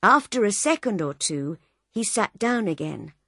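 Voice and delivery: plain reading voice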